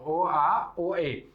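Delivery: slow, spelled-out A and E sounds; slow demonstration. Shown as a demonstration. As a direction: draw out each vowel for the class. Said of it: "ela tem duas, eh, duas sons". (devagar, mostrando os dois sons)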